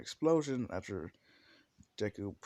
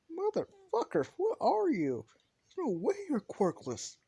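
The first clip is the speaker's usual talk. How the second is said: furious, very high-pitched outburst of disbelief; outraged squawk at an impossible rival; Bakugo; 4 dB louder than usual. Acted. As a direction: yell it shrieking high, enraged and incredulous. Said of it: "Bakugo's getting more and more pissed".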